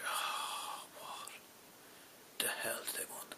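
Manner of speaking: breathed-out, very soft groan of exasperation; weary whisper. Retold or imitated. Worker pinged by yet another agent, weary whisper. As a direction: whisper it on a despairing sigh.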